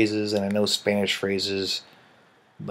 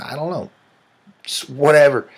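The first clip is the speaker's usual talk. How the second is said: loud, exasperated shrug of a whatever; shrugging dismissal; 5 semitones higher, 7 dB louder than usual. Real, not acted. Wrapping up the accent list, a loud dismissive shrug. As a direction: throw it away with a loud shrug.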